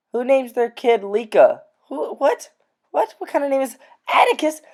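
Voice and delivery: high, fast disbelieving cries; incredulous. Real, not acted. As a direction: react in high pitched disbelief. Incredulous reaction to names.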